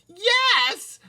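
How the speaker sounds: put-on high child's shout yes; shouting back. A narrator's reading. Toddler shouting back at his mom. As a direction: toddler yells back gleefully. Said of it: "Fudge shouted back".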